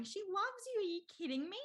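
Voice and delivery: high-pitched, exasperated insistence; incredulous reassurance. Retold or imitated. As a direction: imitate a sister scoffing at the very idea. Sister's incredulous reassurance, retold.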